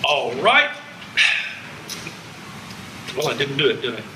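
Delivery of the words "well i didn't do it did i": usual speaking voice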